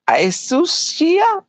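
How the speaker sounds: halting, drawn-out attempt at a name. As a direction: stumble slowly upward into the name.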